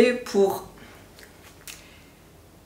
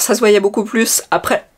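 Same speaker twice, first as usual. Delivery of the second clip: usual speaking voice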